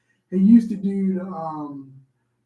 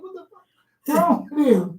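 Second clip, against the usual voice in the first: mock comedian; 8 semitones higher than usual. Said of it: "He said".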